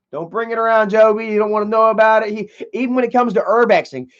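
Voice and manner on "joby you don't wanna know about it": ordinary delivery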